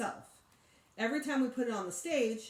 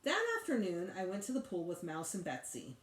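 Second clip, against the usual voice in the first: usual speaking voice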